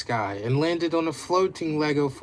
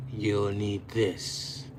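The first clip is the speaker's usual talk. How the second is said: smirking villain; 6 semitones lower, 4 dB quieter, much slower than usual. Acted. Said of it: "Carnage smirked".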